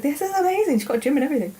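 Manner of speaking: very high, gushing delight; excited amazement. Retold or imitated. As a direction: gush in a high, thrilled voice. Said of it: "I was like".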